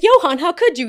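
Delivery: loud, high, hammed-up heartbreak; mock betrayed cry. Accusing Johan of betrayal, hammed-up and loud. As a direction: wail it like a soap opera.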